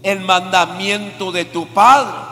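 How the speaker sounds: loud, slow, solemn proclamation; loud solemn scripture reading; proclaimed scripture. Real, not acted. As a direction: declaim the verse like a prophet. Preacher proclaiming scripture verse.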